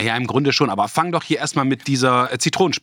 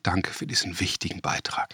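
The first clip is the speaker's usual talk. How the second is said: dry whisper; 3 semitones lower, 9 dB quieter than usual. Acted. Dry reply to a silly answer.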